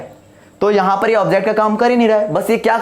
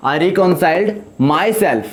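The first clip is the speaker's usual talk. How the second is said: slow dictation; much slower than usual. Shown as a demonstration. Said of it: "sorry".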